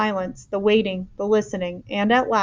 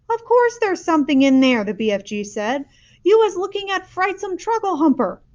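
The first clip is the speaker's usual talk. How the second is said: higher, a touch impatient; impatient giant; 9 semitones higher, 4 dB louder than usual. A narrator's reading. Giant to the girl, mildly impatient. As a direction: correct her briskly.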